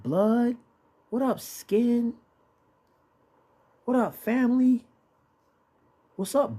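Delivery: high-pitched greetings, hailing kinfolk; slang street greetings. Acted. Preacher acting out how his people greet family, high hailing voice. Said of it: "mostly hear it from? Jake".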